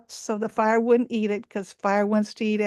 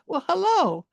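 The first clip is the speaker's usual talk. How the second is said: much higher, squeaky monkey greeting; high friendly monkey voice inviting the lost hunter in; old monkey voice; 6 semitones higher than usual. Acted. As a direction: welcome him in like a kindly old monkey. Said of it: "the old monkey says".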